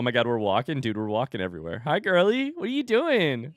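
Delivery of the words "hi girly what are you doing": high sing-song parent voice. Real, not acted. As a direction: greet a little one, lilting up high. Greeting own toddler on camera.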